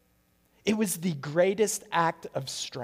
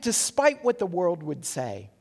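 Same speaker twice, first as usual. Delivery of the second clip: ordinary talk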